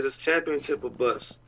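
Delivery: normal talking voice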